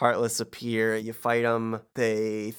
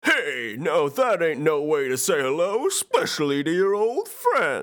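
higher, louder gruff cartoon heavy; Pete voice; 5 semitones higher, 3 dB louder than usual. Asked what Pete sounds like, he does him. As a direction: bluster like a cartoon bully. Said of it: "what does Pete sound like?".

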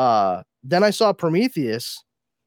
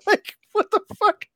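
incredulous; 4 dB louder than usual. Real, not acted. Incredulous at casting.

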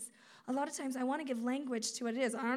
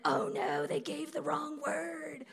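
mock panic; 3 semitones higher, 4 dB louder, slower than usual. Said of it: "The Lord's not freaking out like".

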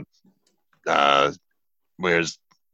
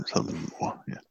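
Southern Moor whispered, much softer; 11 dB quieter than usual. Shown as a demonstration. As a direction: breathe the name as a secret aside. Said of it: "the Southern Moor".

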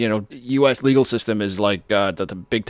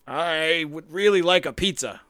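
slow clear request to the phone; 6 semitones higher, slower than usual. Pretend. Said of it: "what can I put down".

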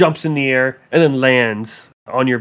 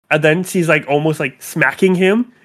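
ordinary talk

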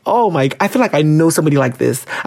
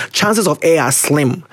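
ordinary talk

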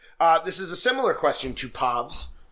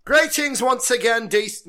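hammed-up, higher and louder celebrity-host voice; Robin Leach impression; 8 semitones higher, 5 dB louder than usual. Reading a fan letter to the co-host as Robin Leach. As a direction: announce it like a showy TV host. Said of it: "I'm Robin Leach".

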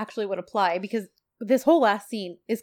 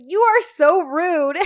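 high, loud, drawn-out indignation; mock affronted; 8 semitones higher, 7 dB louder, slower than usual. Woman shoved into back seat, slow high mock indignation. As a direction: stretch it out in laughing mock offence.

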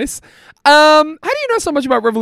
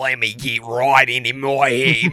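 put-on lower, slow tough guy Australian accent; 7 semitones lower, slower than usual. Asked for his tough guy Australian, he drops into a slow Aussie drawl. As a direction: drag it out like a rough Aussie bloke. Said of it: "tough guy Australian".

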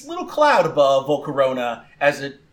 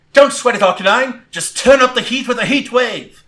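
high, loud rallying cry; rally your fighter and order the attack; calling out; 9 semitones higher, 7 dB louder than usual. Acted. Trainer rallies Arcanine mid-battle, calling out loud. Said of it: "Gwen calls out".

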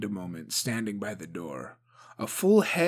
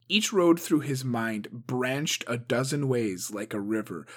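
ordinary delivery

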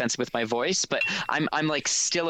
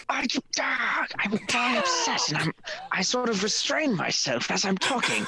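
whisper yelling; 10 semitones higher than usual. Pretend. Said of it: "Give us your best angry whisper".

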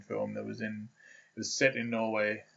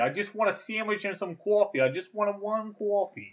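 higher-pitched mock Boston, "coffee" as "kwaw-fee"; mock Boston ordering coffee; hammed-up Boston accent; 10 semitones higher than usual. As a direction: play a pushy Boston customer. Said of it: "your best Boston accent".

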